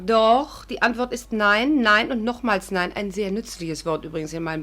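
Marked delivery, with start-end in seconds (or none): 0.6-2.9 each nein drawn out, slow; emphatic refusal. Acted. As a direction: hammer every nein home. (jedes nein betont und gedehnt)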